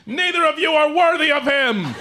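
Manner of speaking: high, loud indignant cry; outraged fan. Shown as a demonstration. Comic shows a fan the next line, high outraged shout. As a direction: play a jealous fan shouting down rivals. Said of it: "you'll be like".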